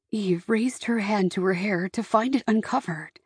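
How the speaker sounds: ordinary delivery, nothing marked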